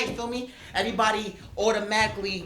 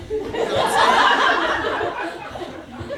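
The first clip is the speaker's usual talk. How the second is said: words lost in a breathy laugh; slow, high, airy laughter over the words; 9 dB louder, much slower than usual. Real, not acted. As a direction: laugh through the question. Laughs at own story.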